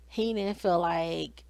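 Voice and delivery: normal talking voice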